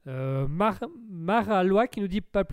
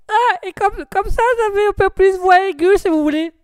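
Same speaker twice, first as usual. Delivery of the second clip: very high, loud put-on squeal; high-pitched voice; 13 semitones higher, 14 dB louder than usual. Shown as a demonstration. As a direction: force it right up to the top. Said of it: "Essayez l'aigu". (voix aiguë forcée, très forte)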